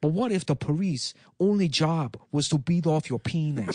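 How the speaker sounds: mock foreign-accented politician; Andrew Yang. Presidential candidate announcing a giveaway, mock foreign accent. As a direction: announce policy in a put-on broken-English accent. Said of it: "learn English, Yang".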